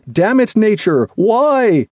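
high-pitched cry railing at the sky; frustrated yell. A narrator's reading. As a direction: yell in exasperation at nature. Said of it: "shook his fist at the sky and yelled".

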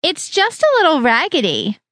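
high, prim, casual put-down; a mother-in-law belittles the bridesmaid's hair, high and prim; Harriet's voice. A narrator's reading. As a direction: drop the insult lightly, high and prim. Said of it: "Harriet said".

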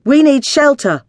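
high, loud cry for shelter; clamouring demand. A narrator's reading. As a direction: call out urgently over other voices. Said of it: "The women all spoke up at once".

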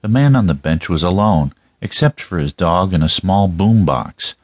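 ordinary delivery, nothing marked

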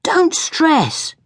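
put-on high-pitched boy's retort; young, high voice; child's protest. A narrator's reading. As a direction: tell mum off lightly as a kid. Boy reassures fussing mother.

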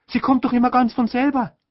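high, loud exasperated mother; voiced mother. A narrator's reading. Mother to daughter about the aunt, high and exasperated.

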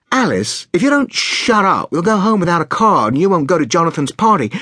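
mother laying down the law; a touch of temper, pitch jumping up; scolding mother. A narrator's reading. Mother threatens her nagging child.

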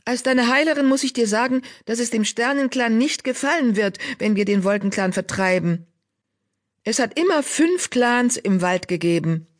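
put-on a young healer speaking up to her leader; a she-cat healer warns her leader with respect. A narrator's reading.

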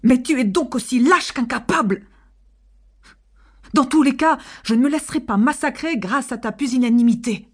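high, scornful outburst; furious wife rebuking her timid husband; exasperated. A narrator's reading. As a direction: scold the coward with contempt. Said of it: "exaspérée".

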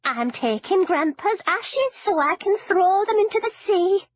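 bubbling over about the plan; granny excited about the ashes. Acted. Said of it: "Granny went on excitedly".

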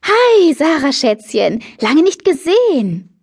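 high, bright-pitched gushing hello; cheerful call. Acted. Lucilla hugs Sarah and greets her with a cheerful call. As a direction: gush over her like an old friend. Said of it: "rief fröhlich".

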